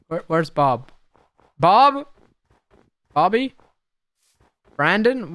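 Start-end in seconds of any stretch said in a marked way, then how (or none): 1.6-5.3 calling out. Real, not acted. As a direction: call out as if searching. Calling for lost mob.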